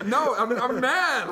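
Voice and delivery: high, loud, drawn-out whine; mock tearful anger. Insisting he's angry while tears come, mock tearful yell. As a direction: whine it as if fake-crying mad.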